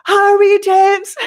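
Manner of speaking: high, loud retold yell; urgent shout. Hurrying a friend to the toilet, urgent shout. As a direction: egg him on with a yell.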